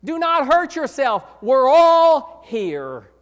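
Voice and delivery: loud urgent call, drawn out slow at the end; retold holler. Prisoners shouting to the jailer not to hurt himself, loud slow holler. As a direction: call out to stop him, all the way up. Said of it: "they hollered at him".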